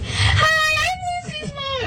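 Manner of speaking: mimicking mom's extremely high-pitched hello; exaggerated sky-high introduction. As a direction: send the greeting way up high, mocking her. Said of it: "It was a little more high than usual".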